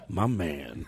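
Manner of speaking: low cool guy voice with a laugh; low mock cool guy; cool guy greeting. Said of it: "He goes".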